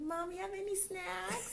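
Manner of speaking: put-on high whiny kid asking; child voice. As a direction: beg mom for snacks like a kid. Imitating childhood self.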